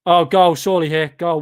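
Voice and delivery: sudden loud cry of goal; real burst of match excitement; excited shout. Real, not acted. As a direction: yell it as the ball flies in. Watching a shot go in.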